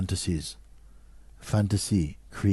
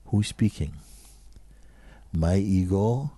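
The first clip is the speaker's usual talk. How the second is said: ordinary talk, nothing marked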